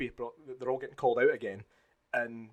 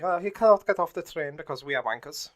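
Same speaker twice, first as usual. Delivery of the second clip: lightly voiced mocking German officer, louder; a hint of a sneering officer; mock officer excuse, lightly accented; generic Nazi voice; 4 semitones higher, 8 dB louder than usual. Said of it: "my generic Nazi voice".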